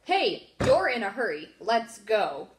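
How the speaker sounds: exasperated yell at a dad who won't leave. Retold or imitated. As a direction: shout it at him, fully fed up. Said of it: "I just yelled".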